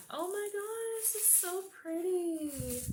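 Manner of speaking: high, gushing delight over a gift; delighted squeal. Real, not acted. Unboxing a pretty pin from a friend, high excited gush.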